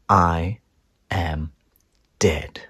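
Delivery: ordinary delivery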